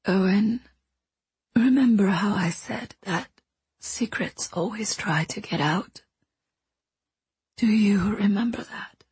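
soft, fading whisper; quiet and distant. Acted. Dying woman confesses a secret to the boy, quiet distant whisper. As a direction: speak from far away, almost gone. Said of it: "her voice quiet and distant".

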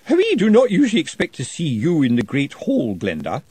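primly disapproving housekeeper; severe housekeeper. A narrator's reading. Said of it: "a severe voice behind her said".

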